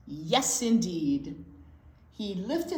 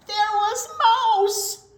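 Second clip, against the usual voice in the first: surprised; 13 semitones higher, 10 dB louder, slower than usual. A narrator's reading. Mouse found in bread drawer.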